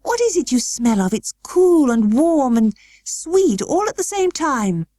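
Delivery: put-on high, slow boy in wonder; wondering sick boy. A narrator's reading. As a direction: savour the smell in wonder. Colin voiced by narrator.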